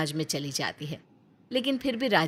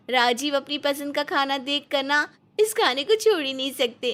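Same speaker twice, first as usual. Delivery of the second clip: much higher, chirpy daughter-in-law voice; sweet young wife; 7 semitones higher than usual. Acted. Young wife Aarti sweetly excusing herself to mother-in-law, chirpy high.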